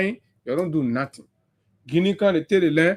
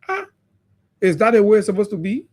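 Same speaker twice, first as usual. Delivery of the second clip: normal talking voice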